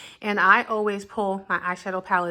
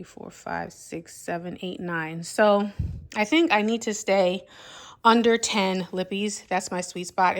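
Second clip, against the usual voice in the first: ordinary delivery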